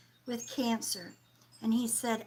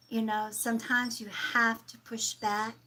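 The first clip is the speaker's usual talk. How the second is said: usual speaking voice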